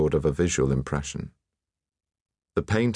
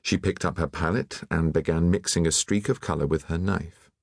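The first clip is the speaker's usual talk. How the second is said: plain reading voice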